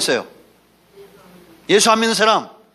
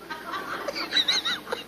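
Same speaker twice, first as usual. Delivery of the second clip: high squealing laugh; 13 dB quieter than usual. Real, not acted. Laughs at own joke. (높고 새된 웃음)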